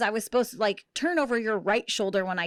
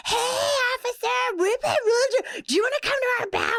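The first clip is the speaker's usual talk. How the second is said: put-on high, giddy drunk party girl pitch, much higher; drunk friend; 12 semitones higher than usual. Drunk friend inviting the officer to their party, high and giddy. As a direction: chirp it up like a tipsy friend inviting a cop over.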